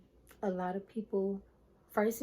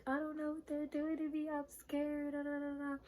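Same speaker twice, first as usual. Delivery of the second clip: much higher, whimpering mock sob; scared crying; 7 semitones higher, 3 dB quieter than usual. She calls her brother from the hospital, imitating a higher scared whimper. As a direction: imitate herself near tears. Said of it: "I was crying".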